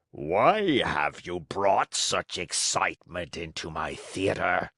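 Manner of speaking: low, slow ogre voice with a cold. A narrator's reading. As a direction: growl it like a sniffly ogre. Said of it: "voice of an ogre suffering with a cold".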